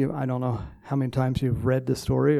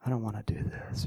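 despairing whisper; 2 semitones lower, 8 dB quieter than usual. Retold or imitated. Depressed Jonah in the boat's bottom, whispered despair. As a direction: breathe it out like all hope is gone. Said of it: "I think he was just going".

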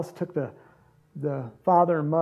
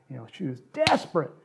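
ordinary talk

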